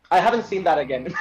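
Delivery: ordinary talk, nothing marked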